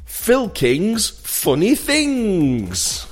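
drawn-out, high swooping game title; stretched, hammy show intro; game show title announcement. Pretend. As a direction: sing out the title like a cheesy host.

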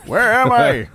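high, loud bewildered dog voice; confused dog voice. Pretend. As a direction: speak as the lost old dog, confused. Voicing the lost old dog.

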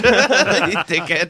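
slow laughing insult. Real, not acted. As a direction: tease him while laughing.

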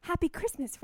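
a mild British lilt on happy Christmas; British accent. Pretend. Giving her gift, she wishes Rachel a mildly British happy Christmas. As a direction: toss off a light English happy Christmas. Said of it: "I like that yours is a little cockney".

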